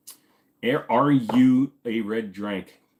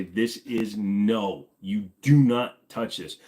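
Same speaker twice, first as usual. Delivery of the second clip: slow, stern, every word spaced; firm parent warning; much slower than usual. Shown as a demonstration. Parent warning a child about a cleared firearm, slow and stern. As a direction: lay down the rule slowly to a child.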